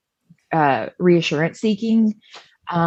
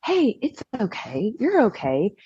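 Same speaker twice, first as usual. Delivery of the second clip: soft, slightly raised soothing voice; calm reassurance; 4 semitones higher, 4 dB quieter than usual. Retold or imitated. Dad to his panicking daughter in the bathroom, calm reassurance. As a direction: gently settle a panicking child. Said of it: "he's pretty level most of the time".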